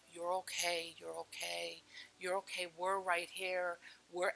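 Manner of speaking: very soft, soothing coo; cooing like a mommy. Retold or imitated. Spirit soothing a drowning woman, softly cooing. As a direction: hush and reassure like a mother. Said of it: "it started cooing like a mommy would".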